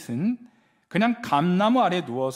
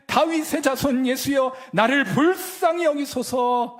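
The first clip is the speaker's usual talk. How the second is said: loud, high desperate plea; crying out; 8 semitones higher, 7 dB louder, slower than usual. Retold or imitated. Blind man crying out to Jesus, loud and high. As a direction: call out over the crowd. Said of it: "외치기 시작합니다".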